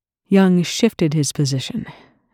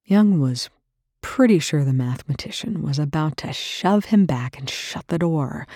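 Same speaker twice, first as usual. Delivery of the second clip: ordinary delivery, nothing marked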